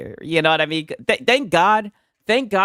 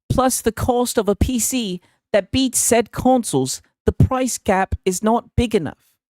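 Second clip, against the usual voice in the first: attempted South England accent; 8 semitones higher, 4 dB louder than usual. Streamer reading out someone's PC gaming post, attempted South England accent. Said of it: "That's a South England accent".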